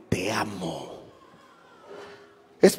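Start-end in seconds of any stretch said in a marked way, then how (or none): 0.1-0.9 very soft, quiet te amo; preacher softly showing a father's love; tender. Shown as a demonstration. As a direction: hush it to your son lovingly. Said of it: "No, no, no".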